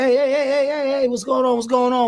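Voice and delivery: high, excited hype-up; high-energy greeting. Retold or imitated. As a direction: burst into the room greeting everyone. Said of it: "my energy, I was like".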